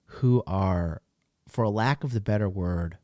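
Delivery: usual speaking voice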